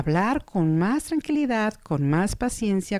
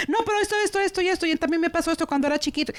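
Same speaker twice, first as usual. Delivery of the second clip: much higher, rushed mock interruption; interrupter; 10 semitones higher, much faster than usual. Patient talking over the other, high rushed chatter.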